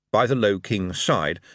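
ordinary delivery

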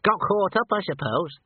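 high squeaky little man. A narrator's reading. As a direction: own up in a squeaky voice. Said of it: "in a squeaky voice".

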